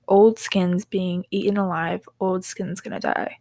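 ordinary delivery

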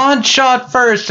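a fan at Comic-Con answering a sign, high and slow; defiant retort. Retold or imitated. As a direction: stretch it out high in protest. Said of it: "some guy comes up and he's like".

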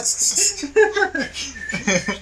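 high, loud burst of laughter; a long, high, loud laugh at the story; hearty laugh. Real, not acted. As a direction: crack up loudly. Laughing at a flirty customer story.

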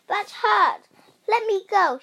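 high, loud protest; shouting. A narrator's reading. Rosie shouting at bullies pulling her ear. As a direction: shout it as if being held. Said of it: "she shouted".

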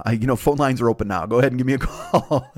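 normal talking voice

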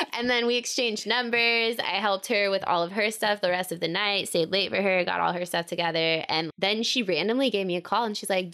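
usual speaking voice

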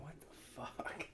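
very quiet, whispered mock disbelief; almost voiceless, drawn-out reaction; hushed disbelief. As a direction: whisper it slowly, as if stunned at yourself. Looking back at his temper, disbelief.

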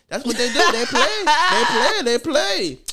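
high, mocking teacher voice with a laugh. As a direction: parrot the teacher's complaint, amused.

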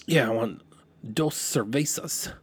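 put-on heavily Anglicized American-accented Spanish. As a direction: imitate a clueless American ordering in Spanish.

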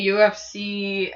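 ordinary delivery

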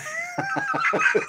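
short high laugh; amused laugh. Real, not acted. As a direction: laugh at the tease. Laugh after teasing remark.

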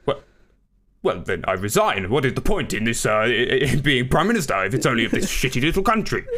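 petulant Prime Minister resigning, breaking into laughter; Boris Johnson. Acted. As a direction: bluster indignantly, then crack up. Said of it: "do your fucking Boris Johnson accent".

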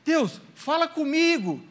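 begging God to answer, slow and high; pleading prayer. Shown as a demonstration. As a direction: drag it out like a desperate prayer. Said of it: "talvez serve pra alguém hoje isso aqui".

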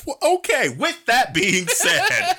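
laughing. Real, not acted. Laughing outro, drawn out.

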